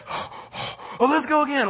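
eager rush. Acted. Disciples rushing back.

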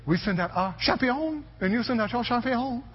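high-pitched cartoon French accent; mock French accent. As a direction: taunt like a little French-accented cartoon warrior. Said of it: "they have a French accent".

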